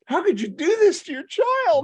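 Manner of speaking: high, loud, appalled scolding; mock outrage. Scolding the mom for making her kid watch, outraged. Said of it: "I'm going to the mom, I'm like".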